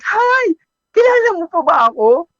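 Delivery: put-on high, loud and fast girl's voice; girlish greeting. Returning customer greeting her barber, high and girlish. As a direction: burst in with a chirpy hello. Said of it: "Ang bungad niya kagad".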